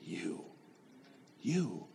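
soft, emphatic you; stress on you. Real, not acted. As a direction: lean on the word you, quietly. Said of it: "what I'm trying to tell you today".

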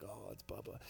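very soft, low murmur; barely voiced awe; awestruck kids. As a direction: imitate hushed admiration. Kids gazing at Bubba in awe.

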